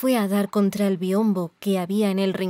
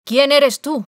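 much louder, higher shout; 6 semitones higher, 9 dB louder, slower than usual. A narrator's reading. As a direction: yell the challenge at him. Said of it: "gritándole". (grito fuerte y agudo)

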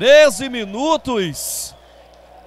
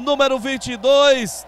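ordinary delivery

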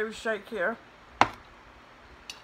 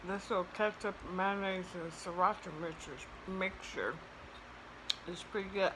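talking while chewing food; muffled chewy speech; mouth full; 6 dB quieter than usual. Real, not acted. Seen 3: Ate fries just before; lips closed, chewing while holding fries.

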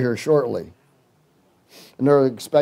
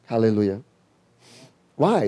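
normal talking voice

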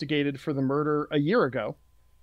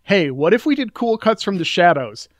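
loud, higher, acting out an eager pitch; punchy, raised-voice imitation of someone's brainwave; excited mock pitch; 4 semitones higher, 9 dB louder than usual. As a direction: pitch the big idea with hammed-up enthusiasm. Acts out the filmmakers' pitch.

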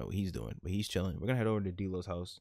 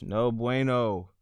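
drawn-out dismay; 4 semitones higher, 6 dB louder, much slower than usual. Real, not acted. Dismayed at the scene.

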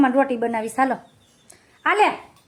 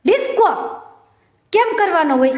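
surprised question; 5 semitones higher than usual. Real, not acted. Mother surprised at her toddler's word, high question. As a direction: echo the word disco? in surprised delight.

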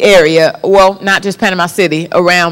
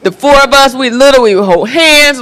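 ordinary delivery

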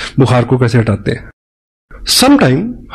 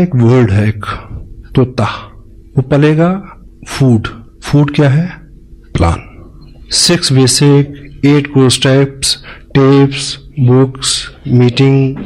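ordinary talk

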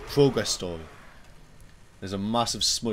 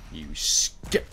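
hissed insult; 5 semitones lower, 6 dB louder than usual. Real, not acted. Insulting a game enemy again.

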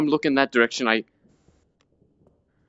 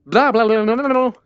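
dismissive sing-song blah blah; mocking blah blah; 6 semitones higher, 4 dB louder than usual. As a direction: brush off the prayer with a mocking blah. Mocking exorcism prayer.

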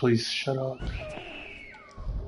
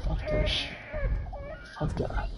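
whispered, panicky curse; scared whisper; 11 dB quieter than usual. Real, not acted. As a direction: hiss it under your breath. Terrified under breath.

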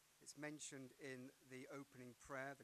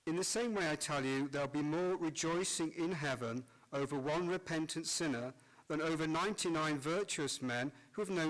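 cathedral echo; 3 semitones higher, 21 dB louder than usual. Acted. Priest proclaims Gospel in church.

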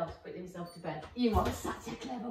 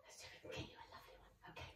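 soft whispered aside; 22 dB quieter, much faster than usual. Real, not acted. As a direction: breathe it under your voice. Quiet aside while training dog.

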